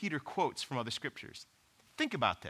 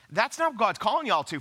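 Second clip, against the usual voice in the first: usual speaking voice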